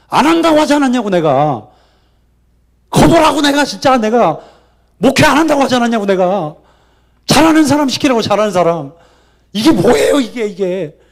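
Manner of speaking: 높고 크게 쏟아내는 원망; 주님께 억울한 듯 소리 높여 따지기. Acted. 하나님께 따지는 장면을 연기. (억울해서 고함치며 항의)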